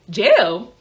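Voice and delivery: loud, startled disbelief; disbelieving. Retold or imitated. Driver reacting to news of being taken to jail, disbelieving. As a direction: blurt it in retold disbelief.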